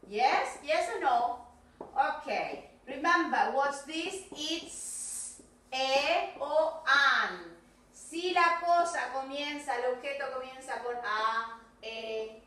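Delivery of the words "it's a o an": very slow, stretched-out words; modelling slowly. Shown as a demonstration. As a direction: model each little word slowly for young learners. Teacher models the answer pattern. (modelando muy despacio, alargando cada palabra)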